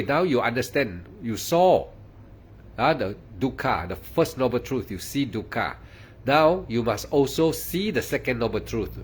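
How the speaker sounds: ordinary delivery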